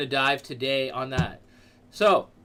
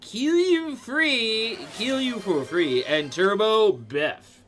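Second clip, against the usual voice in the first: loud, high, slowed-down hype shout-out; excited loud hype; 6 semitones higher, 5 dB louder, slower than usual. Real, not acted. Streamer thanking gifters during a hype train, loud and excited. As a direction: whoop the gifters' names out like an announcer. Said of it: "I don't know why I'm getting so aggressive".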